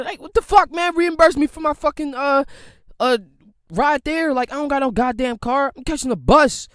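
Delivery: loud, high and furious; venting rage at useless employers; furious shout. Real, not acted. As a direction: yell out the outrage. Ranting about unpaid interview trip.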